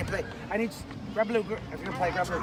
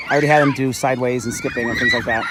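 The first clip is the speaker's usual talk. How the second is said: ordinary talk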